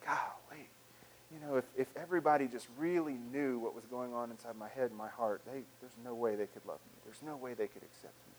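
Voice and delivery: low, soft confession sinking away; voice of shame. Acted. Imagined self hiding struggles, quietly ashamed. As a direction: quietly despair of being loved. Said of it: "That voice of shame".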